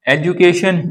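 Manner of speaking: "एजुकेशन" with j, ej-u-kay-shun; one country's variant. Shown as a demonstration. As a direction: say the middle of "एजुकेशन" as ju. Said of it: "एक बोल रहा है". ("एजुकेशन" में जु बोलें)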